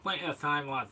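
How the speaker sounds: light mock Scottish accent; brief put-on Scottish lilt; fake Scottish. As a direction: slip a touch of Scotland into it. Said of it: "fake Scottish then".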